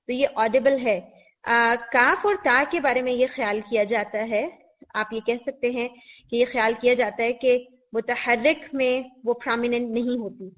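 ordinary talk, nothing marked

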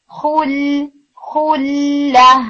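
خلہ drawn out very slowly, round the kha then pull back for lam; relaxed lam. Shown as a demonstration. Tutor modelling خلہ for students, very slow. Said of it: "اس طرح سے".

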